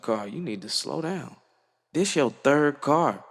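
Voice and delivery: mama scolding, rising to a high incredulous peak; imitating his mama. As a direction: play the exasperated mother. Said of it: "My mama pulled me to the side. She was like".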